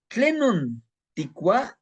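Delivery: "Tlenon tikua" slow and high, carefully sounded; slow Nahuatl demo. Shown as a demonstration. Teacher modeling "Tlenon tikua" for students to repeat. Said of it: "teaching Nahuatl phrase".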